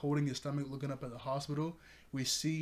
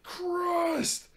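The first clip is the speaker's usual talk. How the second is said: high, loud drawn-out shout; hammed-up shout, held long and high; battle cry; 7 dB louder than usual. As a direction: act out a hero's triumphant yell. Acting Eraserhead's battle cry.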